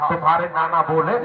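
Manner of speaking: usual speaking voice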